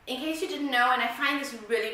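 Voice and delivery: normal talking voice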